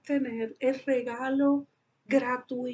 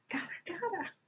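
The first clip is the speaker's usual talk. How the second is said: tearful; 6 semitones higher, 10 dB quieter than usual. Real, not acted. Imagining meeting God, voice breaks.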